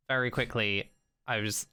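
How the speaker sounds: ordinary talk, nothing marked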